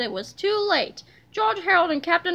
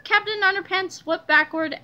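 ordinary delivery

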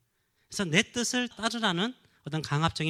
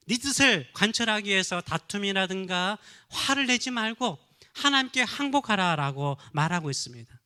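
louder, rising sermon exhortation; fervent preaching; 3 semitones higher, 4 dB louder than usual. Acted. Pastor urging fathers to surrender to God, fervent and loud. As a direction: press the fathers hard from the pulpit.

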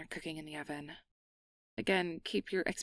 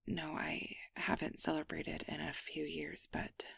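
softer embarrassed whisper; 6 dB quieter than usual. Acted. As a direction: confess it under your breath. Embarrassed confession.

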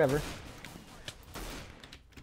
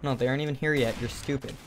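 normal talking voice

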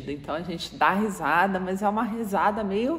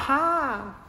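gloating remark; slower than usual. Retold or imitated. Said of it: "você fala".

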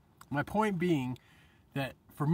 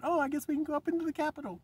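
much higher, innocent mock voice; mock naive crowd; 12 semitones higher, faster than usual. Mocking the crowd entering the Capitol, high and innocent. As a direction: play the crowd as clueless. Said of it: "people said".

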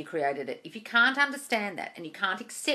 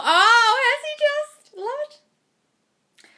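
very high, loud gushing "oh"; delighted squeal; 9 dB louder, much slower than usual. Real, not acted. Gushing over sweet goodnight texts.

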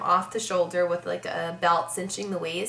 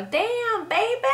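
grandpa's greeting pitched up high; imitating grandpa; 14 semitones higher, slower than usual. Grandpa greeting his granddaughters in the backyard, mimicked high. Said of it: "he'd see us and go".

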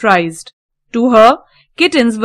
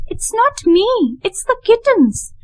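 put-on little girl Heidi; 12 semitones higher than usual. A narrator's reading. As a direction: plead innocently like a little child. Heidi voiced in the story.

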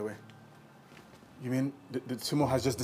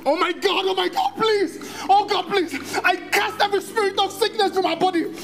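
high-pitched, frantic praying wail; desperate prayer; 10 semitones higher than usual. Acted. Husband praying over his unconscious wife, desperate wailing. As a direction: cry out commands to heaven in anguish.

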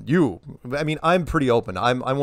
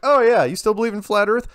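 much higher and louder mock reporter jab; 10 semitones higher, 6 dB louder than usual. Reporters pestering the player after the game, high mock jab. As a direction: needle him like a pushy reporter. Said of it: "it's like".

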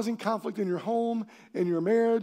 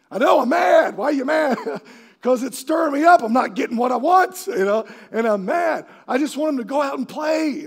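loud, high hammed-up parent yelling; mock angry parent; 6 semitones higher, 9 dB louder than usual. Parent yelling about kids who won't go play. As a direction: play the fed-up parent shouting.

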